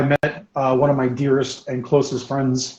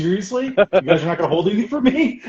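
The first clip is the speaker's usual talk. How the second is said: higher-pitched playful disbelief; mock incredulous; 6 semitones higher than usual. Customer complaining to the store that nothing was held, mock incredulous. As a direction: protest in acted disbelief, laughter creeping in.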